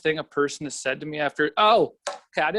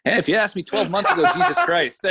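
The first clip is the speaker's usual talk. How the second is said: loud, high, breaking into laughter; laughing answer; 7 semitones higher, 5 dB louder than usual. Real, not acted. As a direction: answer through real laughter. Laughing through his answer.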